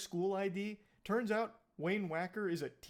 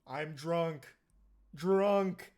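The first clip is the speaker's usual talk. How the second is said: caveman voice; 5 dB louder, much slower than usual. Retold or imitated. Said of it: "Speaking like a caveman".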